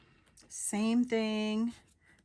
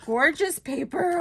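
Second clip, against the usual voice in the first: excited squeal; 8 semitones higher, 7 dB louder than usual. Real, not acted. Excited paper haul reaction.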